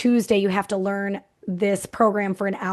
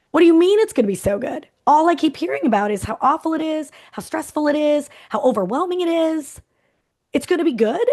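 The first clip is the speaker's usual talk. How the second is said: higher, louder, mock disbelief; incredulous; 6 semitones higher, 3 dB louder than usual. Coach questioning her clients, higher and incredulous. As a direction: act out a wide-eyed, half-teasing double take.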